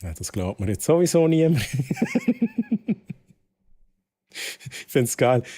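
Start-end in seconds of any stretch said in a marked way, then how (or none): 1.7-3.1 squealing high laugh. Real, not acted. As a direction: burst into a high-pitched laugh. Laugh after remark.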